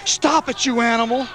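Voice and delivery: very high screamed protest. A narrator's reading. Woman rushing to Garth's aid, screaming at Wolf. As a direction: scream at the attacker to stop. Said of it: "screamed a woman".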